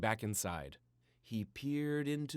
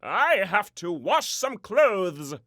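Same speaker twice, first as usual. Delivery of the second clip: much louder, higher, slow; exclaimed; 8 semitones higher, 13 dB louder, slower than usual. A narrator's reading. Daddy making a fake excuse to leave, loudly exclaimed. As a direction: proclaim the excuse. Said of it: "exclaimed Daddy".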